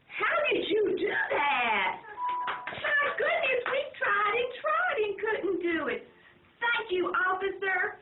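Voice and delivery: loud, high, slow amazement; overjoyed family thanking the policeman; amazed. A narrator's reading. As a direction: marvel loudly at the officer.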